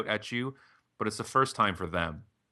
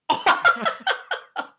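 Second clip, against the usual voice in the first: loud, very high real laugh; burst of laughter; 9 dB louder than usual. Real, not acted. Podcast host reacting to the guest's weight punchline, laughing hard. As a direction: crack up at the punchline.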